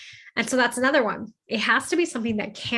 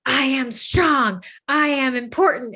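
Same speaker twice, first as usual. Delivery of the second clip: higher, emphatic imitation of affirmations; raised, punchy affirmation voice; strong emotion; 4 semitones higher, 4 dB louder, slower than usual. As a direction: proudly declare it, imitating a pep talk in the mirror. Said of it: "do it with strong emotion".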